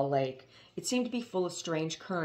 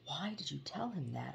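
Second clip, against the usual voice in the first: whispered and fierce; 11 dB quieter than usual. Acted. Haroon silently scolding his father, fierce whisper. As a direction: hiss the thought in frustration. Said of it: "Haroon thought fiercely".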